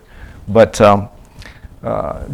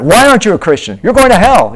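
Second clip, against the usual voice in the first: loud, much higher hammed-up condemnation; mock accusation; 10 semitones higher, 8 dB louder, faster than usual. Missionary imitating evangelist condemning a non-believer, loud. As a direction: hurl the warning at him. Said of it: "if they say they aren't".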